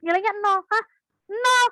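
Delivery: rising scornful "Nol?" from a parent; a parent mocking the zero score in shock; shocked disbelief. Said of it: "orang tua tuh gini".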